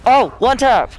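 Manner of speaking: high, loud hyped cheer; excited shout. Real, not acted. Loud celebration.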